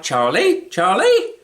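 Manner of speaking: loud shout, rising high on the second Charlie. Retold or imitated. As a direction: bellow the name like a bossy wife. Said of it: "You can hear Janine shout".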